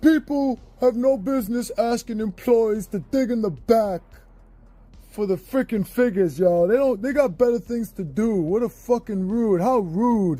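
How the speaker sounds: put-on outrage of an internet troll; imitated online troll. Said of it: "people to troll it out and just go".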